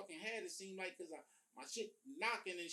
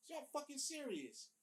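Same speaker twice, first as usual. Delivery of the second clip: shocked disbelief; 9 semitones higher than usual. Retold or imitated. Hearing what Bati Boy means.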